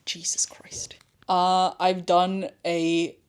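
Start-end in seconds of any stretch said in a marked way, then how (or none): 0.1-1.0 whisper. Real, not acted. Exasperated aside.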